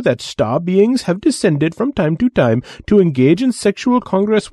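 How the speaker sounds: high, excited speculating voice; lightly voiced eager scholar.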